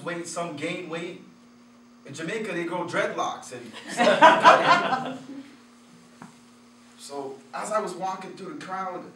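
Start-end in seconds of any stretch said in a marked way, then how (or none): 3.8-5.5 loud high laugh breaking out; real amused laugh; burst of laughter. Real, not acted. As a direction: laugh out loud at your own joke. Laughing at dreadlock joke.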